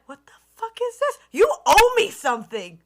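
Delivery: retold outrage. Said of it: "I was like".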